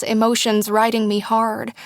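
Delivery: ordinary delivery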